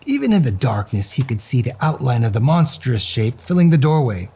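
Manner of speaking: ordinary delivery, nothing marked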